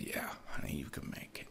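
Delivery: hushed, near-whispered comfort; very soft and low, gently reassure her; tender reassurance. Retold or imitated. Speaker answers struggling woman asking can I make it, tender whisper.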